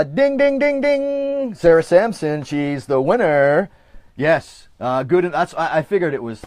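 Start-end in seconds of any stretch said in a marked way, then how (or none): 0.0-3.7 high, slow ding ding ding; game show announcer. Acted. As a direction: announce the winner like a quiz host. Announcing the contest winner.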